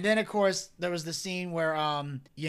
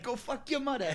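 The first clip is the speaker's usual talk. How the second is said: higher-pitched wiseguy impression, lightly done; Joe Pesci; 6 semitones higher, 3 dB quieter than usual. Storyteller replays favorite Pesci insult, lightly voiced defiant impression. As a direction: imitate a cocky mobster, pitched up. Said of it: "you got his, uh, impression down".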